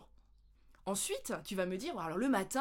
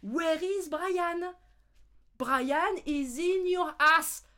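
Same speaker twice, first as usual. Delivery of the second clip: higher, very slow, stilted schoolbook English; mock textbook English; 7 semitones higher, 7 dB louder, much slower than usual. Mocking the school lesson with a silly dialogue. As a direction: drone it like an absurd classroom tape. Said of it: "première leçon d'anglais".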